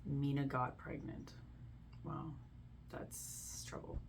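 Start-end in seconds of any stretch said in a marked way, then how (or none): 2.0-4.0 breathy whispered aside, slow and drawn out. Real, not acted. Reacting to the Reddit story, in a slow hushed whisper. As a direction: drop to a slow hush, almost under your breath.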